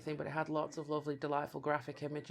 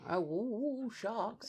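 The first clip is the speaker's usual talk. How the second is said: mock excitement; 4 semitones higher, slower than usual. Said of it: "internet going".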